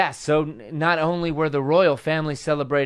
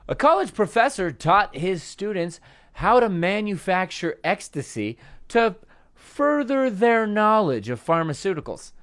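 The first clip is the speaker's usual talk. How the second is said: ordinary talk, nothing marked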